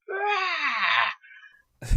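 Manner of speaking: high, strained monster scream; high-pitched scream without full volume; imitated big scream. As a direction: mimic a villain's big roaring scream. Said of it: "he does his big scream".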